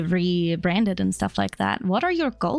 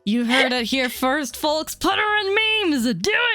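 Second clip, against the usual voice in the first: mock announcer; 7 semitones higher than usual. Hyping up audience, announcer style.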